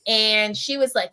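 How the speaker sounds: usual speaking voice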